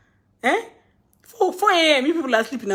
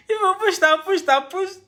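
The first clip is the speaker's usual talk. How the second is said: higher, laughing through the repeats; laughing complaint; 6 semitones higher than usual. Real, not acted. To followers who pressured her, laughing higher. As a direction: laugh while you pile on the pushed.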